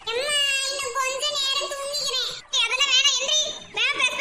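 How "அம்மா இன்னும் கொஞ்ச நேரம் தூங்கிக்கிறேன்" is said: slow, soft, half-asleep plea; sleepy whine. Acted. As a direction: mumble a plea for more sleep. Child being woken begs more sleep. (தூக்கக் கலக்கத்தில் மெதுவாக கெஞ்சுதல்)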